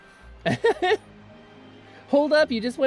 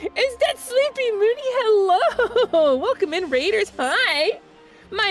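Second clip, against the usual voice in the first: cute anime streamer; 10 semitones higher, slower than usual. Acted. Greets raid in streamer persona.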